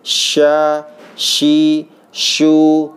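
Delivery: sha, shi, shu in rising drill chant; teacher drilling Arabic letter shin; makhraj drill. Shown as a demonstration.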